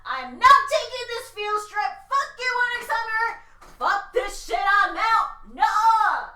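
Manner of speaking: mock outrage. Real, not acted.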